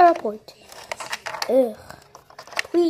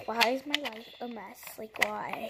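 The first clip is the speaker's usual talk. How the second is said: whispered; 4 semitones lower, 11 dB quieter than usual. Pretend. Muttering complaint to herself.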